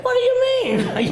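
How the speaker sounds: "What do you mean?" jumps very high and loud; mock surprise. Recalling his fake confusion at the clinic, mock surprise. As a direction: play up the innocent shock. Retold own indignant question.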